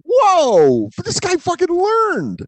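high, slow mock-astonished marvel; amazed. Retelling how the apologising guy left him floored, mock-amazed. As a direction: act floored that the guy changed.

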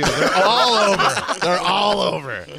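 loud, slow laughing boast; mock boast about hoes everywhere. Real, not acted. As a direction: laugh it out, drawn out.

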